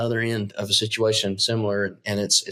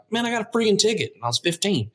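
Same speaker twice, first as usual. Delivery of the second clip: higher, faster indignant complaint; indignant kid; 10 semitones higher, faster than usual. Pretend. Fifteen-year-old complaining about a ticket, higher and faster. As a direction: blurt it out like a sore teen. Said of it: "where you're like".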